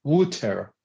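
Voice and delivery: tentative British-style "water"; British accent. Shown as a demonstration. Guest guesses at the British word, British accent. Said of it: "And British, I guess, I'm not sure, but maybe".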